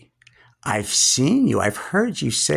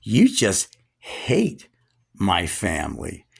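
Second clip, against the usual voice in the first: usual speaking voice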